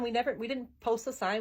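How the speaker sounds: ordinary talk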